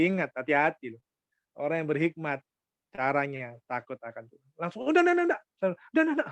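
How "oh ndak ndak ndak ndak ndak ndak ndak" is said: high-pitched string of hurried no's; acted flustered refusal. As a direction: comically fend someone off while escaping. Acts out refusal while fleeing.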